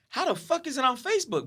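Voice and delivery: high-pitched, baffled exclamation; incredulous. Retold or imitated. Reacting to hearing it is on Facebook, high incredulity. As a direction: let the pitch jump in disbelief.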